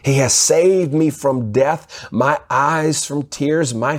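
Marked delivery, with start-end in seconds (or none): none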